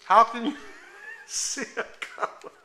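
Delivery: giggling question, half under the breath; laughing. Real, not acted. Amused at the child's question.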